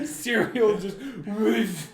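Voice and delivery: slow, high words squeezed through laughter; laughing mom. Retold or imitated. Mom in the car laughing out the words. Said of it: "my mom's like".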